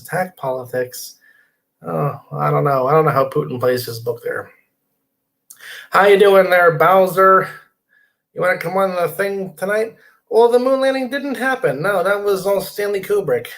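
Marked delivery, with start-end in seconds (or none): 5.9-7.5 louder friendly hail; calling out. Real, not acted. Host calling out again to viewer Bowser. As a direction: call his name to pull him in.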